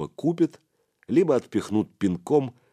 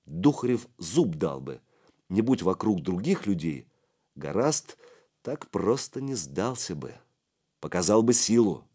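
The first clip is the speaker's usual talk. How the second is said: ordinary delivery, nothing marked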